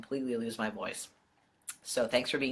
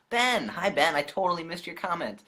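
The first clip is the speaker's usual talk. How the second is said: excited; 7 semitones higher, 5 dB louder than usual. Real, not acted. Excited greeting to a viewer.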